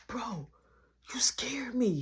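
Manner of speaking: hushed whisper; whispering to his brother at night. Retold or imitated. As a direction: whisper so mom won't hear.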